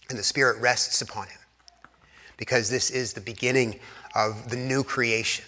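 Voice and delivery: low, hushed, reverent storytelling; quiet, spacious scriptural recital; solemn preaching. Acted. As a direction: drop low and soft, leaving weighty pauses. Hushed retelling of creation and baptism.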